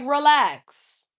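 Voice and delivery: loud, firm order to relax; sharp relax. Retold or imitated. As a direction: cut him short with a loud relax. Telling a pushy guy off.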